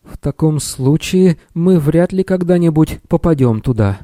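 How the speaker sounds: high, fearful little wizard; frightened. A narrator's reading. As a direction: lament in a high voice, scared. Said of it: "стуча зубами от страха".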